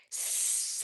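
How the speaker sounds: drawn-out unvoiced hiss; long hiss. Gollum hisses at Bilbo over the riddle. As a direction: hiss like a snake. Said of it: "hisses Gollum".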